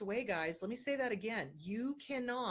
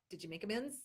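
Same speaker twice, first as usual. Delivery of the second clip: normal talking voice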